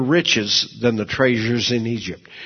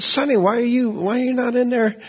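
higher, puzzled calling out; surprised call; 11 semitones higher than usual. Retold or imitated. Man finding Sonny outside, calling out puzzled and high. Calls to sister outside restaurant.

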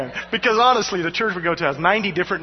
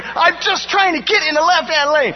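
exasperated thought; 9 semitones higher, 5 dB louder than usual. Retold or imitated. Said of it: "And I'm thinking".

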